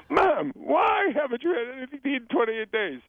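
high-pitched incredulous outcry; exasperated, alarmed son. Acted. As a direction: cry out as a son aghast at his mother. Acting alarmed son in joke.

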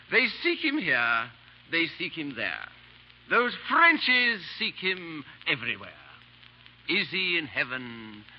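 slow, lightly hammed-up poem recital; reciting verse. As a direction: recite his own verse with mild flourish. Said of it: "I want you to hear my verse".

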